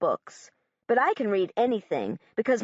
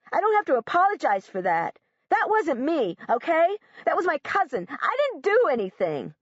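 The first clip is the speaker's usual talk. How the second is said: agitated defensive; 8 semitones higher than usual. Acted. Josh defends himself, agitated.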